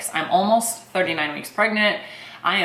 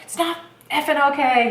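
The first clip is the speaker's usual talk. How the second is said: higher, mock-exasperated snap back; frustrated retort; 8 semitones higher than usual. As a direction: fire it back at him, half-joking fury. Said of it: "And I'm like".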